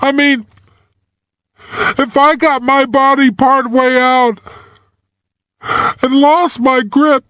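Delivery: put-on trapped boy losing hope, on the verge of tears; near tears. A narrator's reading. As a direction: let the words almost crack into tears. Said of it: "He sounded on the verge of tears".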